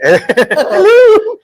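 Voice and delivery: high, loud giggling hello; laughing hello. Real, not acted. Host laughing while greeting the stand-in guests, high giggly hello. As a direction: greet them through a laugh.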